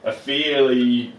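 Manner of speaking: ordinary talk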